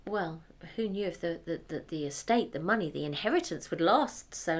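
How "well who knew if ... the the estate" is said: usual speaking voice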